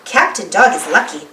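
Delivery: ordinary delivery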